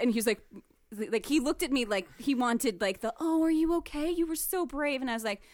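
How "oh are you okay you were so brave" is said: mock fawning sympathy. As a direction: fuss over a hero, mockingly. The sympathy he wanted, acted.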